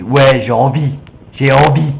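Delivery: loud, emphatic declaration of desire; mean it from the heart, punched out; envie, avec cœur. Shown as a demonstration. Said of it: "tu prends ton cœur". (avec cœur, fort et décidé)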